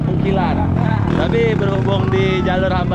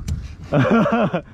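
rapid string of chuckles; real teasing laughter; amused laugh; 2 semitones higher than usual. Real, not acted. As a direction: crack up at your own joke. Laughs at friend's nickname.

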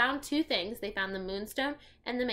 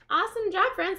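cheery praise; 11 semitones higher, 8 dB louder than usual. Real, not acted. Reader praising the children after the story, bright and loud. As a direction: celebrate the listeners' good work. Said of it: "praising young listeners".

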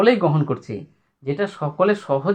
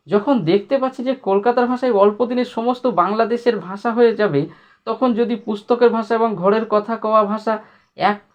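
raised, declaiming textbook prose; formal reading aloud; 5 semitones higher than usual. Acted. Teacher reading the essay's line to students in a raised declaiming voice. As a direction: read the old essay out to the class. Reads essay passage to class.

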